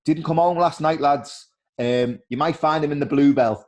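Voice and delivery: lightly voiced mum answering the door; his mum. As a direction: tell the lads where he's likely gone.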